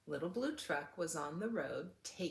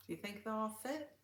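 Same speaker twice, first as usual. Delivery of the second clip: ordinary delivery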